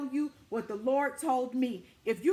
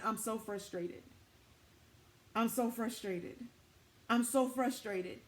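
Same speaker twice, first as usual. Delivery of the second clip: frustrated muttering; 4 semitones lower, 7 dB quieter than usual. Retold or imitated. Preacher recalling herself walking around frustrated, low weary mutter. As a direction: grumble it under your breath like you're worn down.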